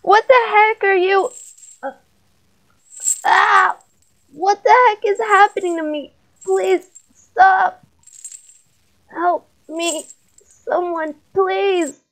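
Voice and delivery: high, panicked cries, pitch rising; terrified screams for help. Acted. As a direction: beg for help as something takes over your body. Boy being possessed by demon.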